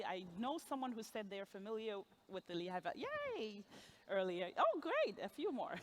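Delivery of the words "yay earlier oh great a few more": ordinary talk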